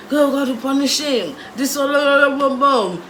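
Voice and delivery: dramatic mom curse. Retold or imitated. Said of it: "My mom went and was like".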